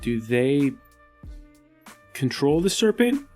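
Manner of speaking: normal talking voice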